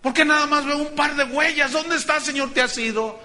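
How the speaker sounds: high, loud anguished cry to the Lord; desperate cry. Retold or imitated. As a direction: call out to the Lord as if abandoned. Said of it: "Y grité". (grito angustiado y agudo)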